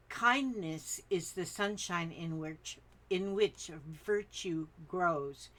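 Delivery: slow, deliberate reading of a quote. Acted. Reading the daily quote to viewers, slow and deliberate. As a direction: let each word of the quote land slowly.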